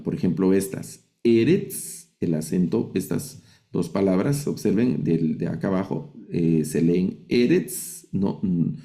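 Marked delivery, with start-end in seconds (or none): 1.2-2.1 "Eretz" stressed on the first syllable, E; penultimate stress. Shown as a demonstration. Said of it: "se acentúan en la penúltima sílaba". 7.3-8.1 "eretz" slowly, stressed on the first E; penultimate stress. Shown as a demonstration. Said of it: "el acento va en la penúltima sílaba".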